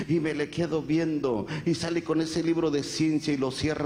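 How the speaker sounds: normal talking voice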